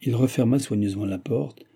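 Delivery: ordinary delivery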